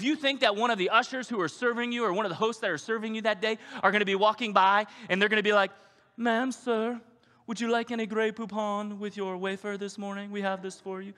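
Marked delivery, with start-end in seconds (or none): 6.2-11.1 high, genteel country-club waiter voice; mock posh usher. Usher offering Grey Poupon at communion, mock posh. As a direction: serve it like a snooty butler.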